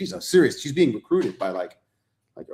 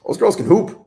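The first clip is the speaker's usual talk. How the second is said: excited; 10 semitones higher, 7 dB louder than usual. Real, not acted. Enthusing about women's basketball.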